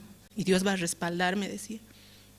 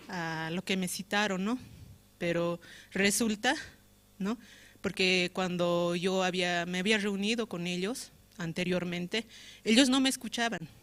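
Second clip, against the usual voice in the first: ordinary delivery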